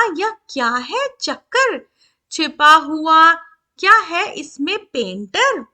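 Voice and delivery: put-on slow, measured verse reading for children; poem recitation. A narrator's reading. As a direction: stretch each word with a lilting rhythm. (बच्चों के लिए लय में कविता-पाठ)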